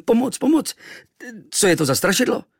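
put-on boy woken by a monster, crying for help; terrified cry. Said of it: "Vyrazil jsem ze sebe".

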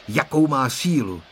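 usual speaking voice

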